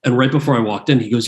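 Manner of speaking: ordinary delivery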